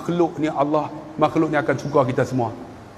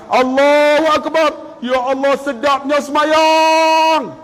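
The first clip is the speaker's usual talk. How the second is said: rapturous exclaim; 9 semitones higher, slower than usual. Acted. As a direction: savour every word, then cry out. Rapturous prayer acted out.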